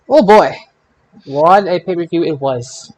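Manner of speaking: usual speaking voice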